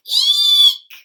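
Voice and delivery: loud high squeak; tiny shrieking eek. Acted. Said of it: "he let out a".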